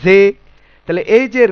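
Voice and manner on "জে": "জে" with an e vowel in place of a; majhul pronunciation. Shown as a demonstration. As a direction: show the old faulty letter name "জে". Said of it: "জাকে কি বলতাম?".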